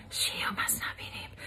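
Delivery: whispered name-dodging aside; conspiratorial whisper; hushed aside. Real, not acted. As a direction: drop to a whisper for the secret part. Whispering the unnamed person.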